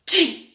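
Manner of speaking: chi fuerte de estornudo; estornudo fingido. Shown as a demonstration. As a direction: estornuda de mentira. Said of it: "Así". (estornudo de demostración)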